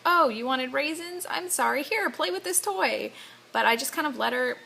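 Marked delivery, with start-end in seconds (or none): 0.0-3.1 higher, sing-song placating parent voice, lightly mocked; mock distracting parent. Mom mocking the distracting parent she avoids, higher and sing-song. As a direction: chirp it up to steer a child off crying. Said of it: "I try not to be like".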